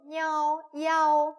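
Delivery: 이아오 run together fast as one sound; quick model syllable. Shown as a demonstration. Teacher shows students the fast 이아오. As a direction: say 이아오 quickly, high and loud. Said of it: "좀 빨리 읽으시면 돼요".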